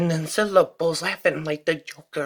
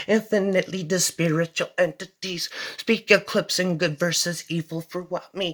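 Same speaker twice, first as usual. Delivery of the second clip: usual speaking voice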